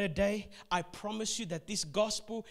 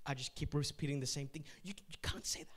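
hushed aside; 6 semitones lower, 7 dB quieter, faster than usual. Real, not acted. Aside breaking off the story.

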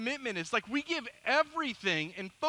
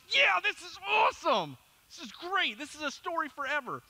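sarcastic glee; 7 semitones higher than usual. Retold or imitated. Sarcastic joy at nurse's remark.

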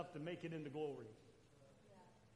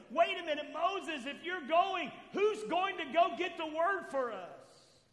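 raised, pleading call to Moses; people calling out; 7 semitones higher, 6 dB louder than usual. Retold or imitated. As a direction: call after him, louder and higher.

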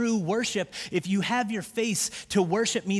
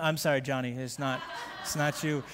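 softer, chuckling through the words; laughing apology; 6 semitones lower, 5 dB quieter than usual. Real, not acted. A real chuckle through a quick sorry. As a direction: pastor apologizing to a Johnny, laughing. Laughs at his own joke.

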